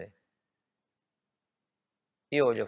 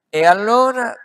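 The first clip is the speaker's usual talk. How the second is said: sudden loud high cry; preacher's peak exclamation; shout; 13 semitones higher, 17 dB louder than usual. Real, not acted. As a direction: burst out at the top.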